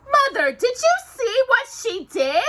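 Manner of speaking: high, loud whiny complaint; whining. Acted. Drusilla complaining to her mother, whining. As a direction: whine it to mother. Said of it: "Drusilla whined".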